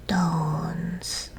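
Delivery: put-on whispered baby Dones; toddler's Dones. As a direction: say Dones with a D for Jones. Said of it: "She said it very loudly".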